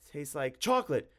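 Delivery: high, loud, frantic bluff; feverish improvising. A narrator's reading. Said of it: "Tim thought feverishly".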